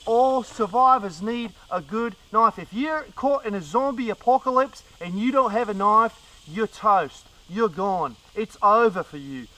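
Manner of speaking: normal talking voice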